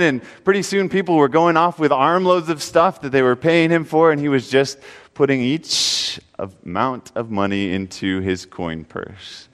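ordinary delivery

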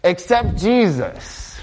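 high, slowed-down "accept Jesus"; imitating churchgoers, sing-song; mock preachy pitch. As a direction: parody a glib evangelist. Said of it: "we tell people".

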